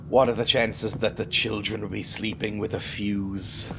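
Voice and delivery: normal talking voice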